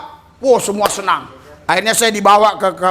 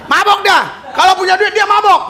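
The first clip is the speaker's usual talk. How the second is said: much higher and louder, exclaiming; emphatic exclaim; 11 semitones higher, 4 dB louder than usual. Real, not acted. Preacher telling the congregation about drunk Papuans, loud and high. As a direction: cry it out to the congregation.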